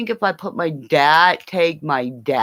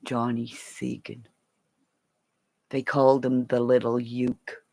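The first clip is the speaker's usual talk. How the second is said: ordinary talk, nothing marked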